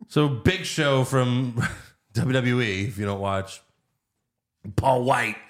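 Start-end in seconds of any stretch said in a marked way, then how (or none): none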